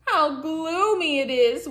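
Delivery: usual speaking voice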